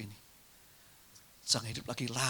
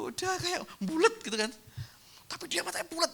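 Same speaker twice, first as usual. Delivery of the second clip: excited. Acted. Imitating wide-eyed listener.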